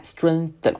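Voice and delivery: "Strengthen" with the tongue a quarter between the teeth; tongue-bite th. Shown as a demonstration. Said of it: "大约是咬舌头四分之一". (舌尖咬在齿间，读"Strengthen")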